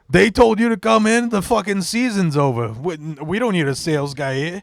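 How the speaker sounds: mocking shop guy. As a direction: do the smug motorcycle-shop guy laughing you off. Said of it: "That's a good impression".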